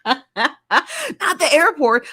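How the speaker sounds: drawn-out words through real laughter; laughing. Real, not acted. Host cracking up at a viewer stuck in the airport, laughing.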